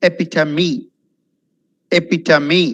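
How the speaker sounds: EPITOME ending in a clear "mee"; correct pronunciation. Shown as a demonstration. Teacher modelling Epitome for learners, correct pronunciation. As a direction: say Epitome as four syllables, e-pi-tuh-mee.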